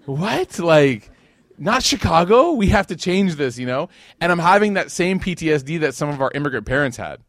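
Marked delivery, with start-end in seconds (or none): 0.0-3.6 high, loud mock disbelief; mock outrage. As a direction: act shocked that it's not Chicago. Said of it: "I was like".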